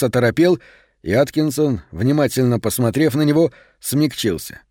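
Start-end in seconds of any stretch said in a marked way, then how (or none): none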